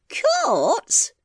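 put-on very high, quavering old voice. Deaf old wife mishearing her husband, quavering. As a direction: quaver it as a puzzled question. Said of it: "she quavered".

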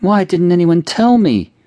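high, loud, slow wail through tears; a little girl sobbing out the question; tearful child. A narrator's reading. Six-year-old Cindy wailing to her mother through tears. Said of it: "tears streaming down her face".

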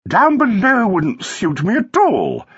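high, drawn-out dismissal; breezy wife. A narrator's reading. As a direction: wave it away in a high, slow voice.

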